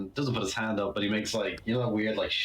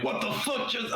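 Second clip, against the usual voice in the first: mock scream; 13 semitones higher than usual. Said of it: "screaming into his ear".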